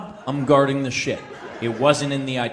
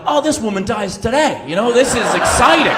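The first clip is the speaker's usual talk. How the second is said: loud, high gleeful glee at the coming showdown; gleeful crowd; 8 semitones higher, 8 dB louder than usual. Retold or imitated. Airport crowd thrilled at the woman's comeuppance, loud and gleeful. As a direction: cheer like a crowd awaiting a fight. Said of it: "we're like".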